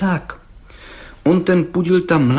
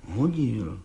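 stubborn mumble; 2 semitones lower, 7 dB quieter than usual. Acted. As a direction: mumble it softly, refusing to budge. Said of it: "mumlal Václav Lisický".

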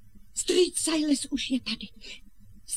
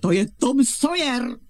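loud, excited reveal; triumphant announcement; 6 dB louder than usual. Acted. Aunt revealing the visitor to her husband, triumphant. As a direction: announce it at full voice as a grand surprise.

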